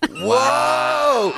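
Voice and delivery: long, high-pitched whoa; impressed whoop. Real, not acted. Host hearing friend's wild party story, amazed high whoop. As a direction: draw it out, soaring high.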